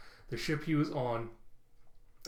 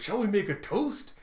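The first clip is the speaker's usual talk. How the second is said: higher-pitched, exuberant invitation; eager proposal; 7 semitones higher, 4 dB louder than usual. Retold or imitated. Frankie proposing a toast to the crew, exuberant.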